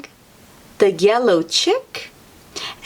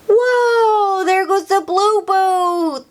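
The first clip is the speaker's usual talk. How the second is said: high excited cry; excited whoa; 11 semitones higher than usual. Acted. Teacher excitedly showing the children the blue boot. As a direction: gasp at the boot in delight.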